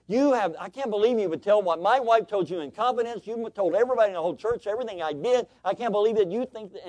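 loud, high, nonstop tirade; yelling. Retold or imitated. Furious husband yelling at the preacher in his office, loud and high. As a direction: rant without taking a breath. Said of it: "he just kept yelling at me".

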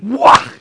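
loud, rough whoosh of impact; explosive "whah". Acted. Preacher imitating fire consuming the second fifty, explosive sound effect. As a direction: blast out a mimicked strike.

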